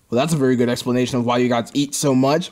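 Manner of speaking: ordinary delivery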